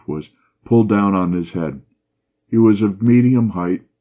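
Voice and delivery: usual speaking voice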